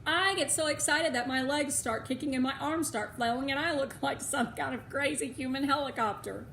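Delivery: usual speaking voice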